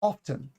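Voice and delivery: "often" as off-ten, T released; T pronounced. Shown as a demonstration. Teacher proving the T is there, crisp T pronunciation. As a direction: put a clear T in often. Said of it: "The T is there".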